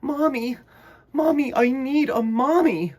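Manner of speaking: high, loud wailing plea; mock crying. Choco crying for a mommy, mock wail. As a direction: wail like a little lost chick. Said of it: "he started to cry".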